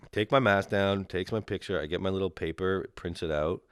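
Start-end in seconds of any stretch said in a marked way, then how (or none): none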